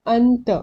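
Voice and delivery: अंत with the noon fully sounded; normal reading. Shown as a demonstration. Teacher showing how it is usually read, full noon. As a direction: say अंत with a clear n before the t. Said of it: "आम तौर पे कैसा पढ़ते हैं इसको".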